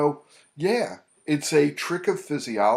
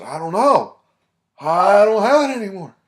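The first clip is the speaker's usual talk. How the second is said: higher and louder, baffled client; client's reply; 8 semitones higher, 11 dB louder than usual. Retold or imitated. Client telling the therapist his anxiety is gone, louder. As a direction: blurt it out in surprise. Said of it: "He said".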